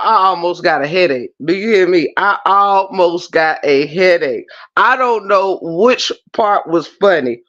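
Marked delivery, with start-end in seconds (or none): 2.2-4.4 weighty, spaced-out delivery. Real, not acted. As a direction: stretch each word for comic weight. Repeats it slowly for comic emphasis.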